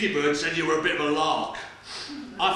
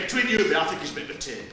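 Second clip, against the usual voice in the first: ordinary delivery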